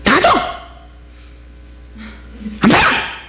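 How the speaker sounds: mock fishmonger barking high; angry shout. Preacher mimicking the tough fish seller, mock angry. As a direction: snap like a stingy seller. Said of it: "이런 사람입니다".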